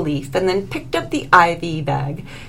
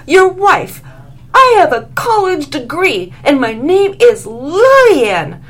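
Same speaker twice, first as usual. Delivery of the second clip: higher, louder, furious outburst; angry shriek; 7 semitones higher, 6 dB louder than usual. A narrator's reading. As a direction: rage at him, climbing into a shriek. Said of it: "she shrieked in anger".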